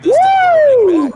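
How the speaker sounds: high, loud celebratory woo; excited whoop. Real, not acted. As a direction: let out a big cheer. Celebrating a play in the game.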